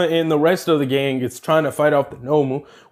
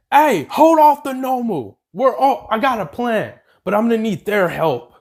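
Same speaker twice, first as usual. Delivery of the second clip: higher, urgent heroine voice; heroine's plan; 7 semitones higher than usual. Acted. Heroine tells Kirishima to hold the Nomu, higher voice. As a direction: lay out the plan in a hurry.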